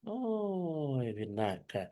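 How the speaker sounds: normal talking voice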